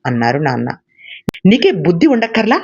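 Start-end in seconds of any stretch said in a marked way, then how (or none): 1.4-2.6 put-on higher, cheeky child's yell; boy shouting back at his father before running off; loud retort. A narrator's reading. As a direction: throw the words back defiantly. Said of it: "గట్టిగా అరిచి".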